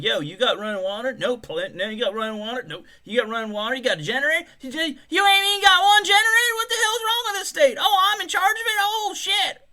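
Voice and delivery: high, loud cartoon voice for the hotel calls; silly high voice. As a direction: ham up the clueless governor.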